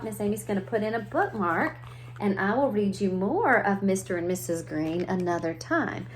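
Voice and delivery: usual speaking voice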